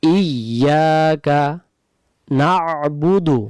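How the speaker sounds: ইয়াকা নাবুদু drawn out slowly with the আইন sound kept; correct slow recitation. Shown as a demonstration. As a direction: recite slowly, keeping every letter. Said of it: "তাহলে আমরা বলবো". (ধীরে সঠিক তিলাওয়াত)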